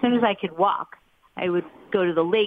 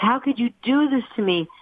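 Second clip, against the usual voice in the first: higher-pitched retold childhood protest; mock yell at parents; 7 semitones higher than usual. Seven-year-old to parents over vanished animals, mild mock yell. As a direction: imitate yelling at parents, without much added volume. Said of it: "I would yell at my parents".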